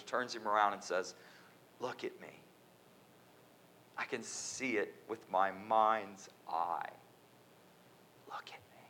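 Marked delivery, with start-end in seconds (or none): 1.8-2.4 soft, close, firm words; quiet firm command. Acted. Jesus speaking softly to the man, quiet and firm. 8.3-8.8 unvoiced intimate whisper; whispered command. Jesus whispering to the pleading man, intimate.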